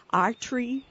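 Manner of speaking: normal talking voice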